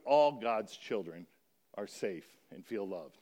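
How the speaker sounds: put-on All God's children said slow and low; slow emphasis. Shown as a demonstration. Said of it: "insert a word in there".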